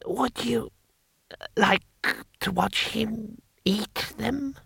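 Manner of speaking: put-on high, soft, slow old ruler; halting, feeble and delighted; ancient emperor, gleeful. Emperor offering a cruel treat, high soft voice.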